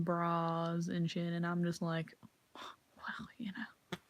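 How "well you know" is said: unvoiced shrugging whisper. Mutter it to yourself about the twerkers, whispered. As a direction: breathe it out under your breath.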